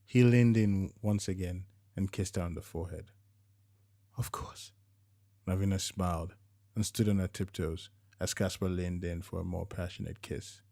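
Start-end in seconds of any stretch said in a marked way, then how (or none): 4.1-4.7 unvoiced hushed whisper; whispered reply to a lover. A narrator's reading. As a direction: breathe the words softly.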